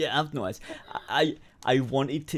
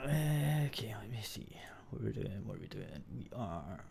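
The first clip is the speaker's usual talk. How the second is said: soft muttering under the breath; mumbling to self; 5 semitones lower, 17 dB quieter than usual. Real, not acted. Friend mumbles to himself while looking something up, quietly. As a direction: quietly think aloud while browsing.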